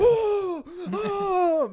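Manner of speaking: high, loud gasping oh; shocked, high-pitched cry of surprise. Retold or imitated. Shocked at hearing him swear.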